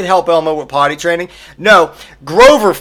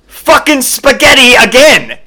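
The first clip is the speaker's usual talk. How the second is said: loud, higher-pitched furious shout; exasperated yell; 7 semitones higher, 7 dB louder than usual. Acted. Comedian revealing Grover's second answer, outraged yell. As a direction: explode in outraged disbelief.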